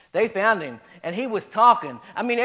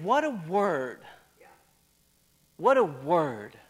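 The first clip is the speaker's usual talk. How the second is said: dropped soft and lower, unhurried; hushed awe; 5 semitones lower, 6 dB quieter, slower than usual. Retold or imitated. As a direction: let the amazement sink into a hush. Amazement repeated, dropped low after shouting.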